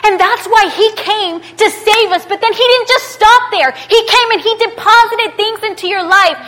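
high, loud, building exhortation; fired-up preaching. Real, not acted. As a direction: preach it with rising force. Preaches at full force.

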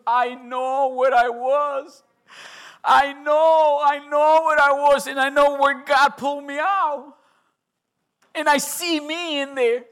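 loud preacher proclaiming the promise; preaching at full force. Acted. (predicación fervorosa y fuerte)

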